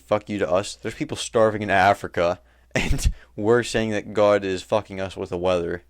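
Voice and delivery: normal talking voice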